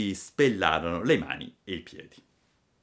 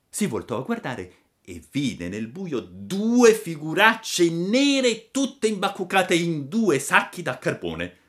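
usual speaking voice

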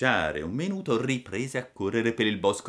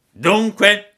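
high, loud villain voice; assassins' demand; 12 semitones higher, 14 dB louder than usual. A narrator's reading. Assassins interrogate the puppet, loud threatening demand. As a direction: demand an answer loudly. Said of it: "gli domandarono gli assassini".

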